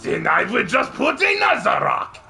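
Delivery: high, hammed-up French cartoon villain. Dam builder answering the rabbit's challenge, mock French villain voice. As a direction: answer cockily in a thick mock French accent. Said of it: "so Jacques keeps upping the dam".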